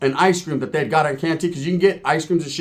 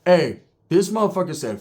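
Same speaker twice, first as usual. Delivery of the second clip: normal talking voice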